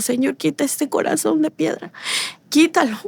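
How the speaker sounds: retold crying prayer. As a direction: beg God as if crying again. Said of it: "Empiezo yo a llorar". (súplica llorosa, más aguda, recordada)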